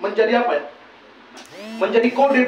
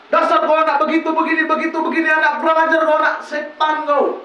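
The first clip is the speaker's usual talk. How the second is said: scolding mother; 6 semitones higher than usual. Retold or imitated. Mother cursing her child, higher mock angry scolding. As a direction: rattle off a mother's angry insults.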